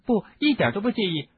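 high squeaky reply. A narrator's reading. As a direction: squeak it out nervously. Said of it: "赫敏尖声说".

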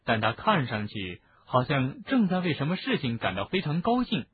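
plain reading voice

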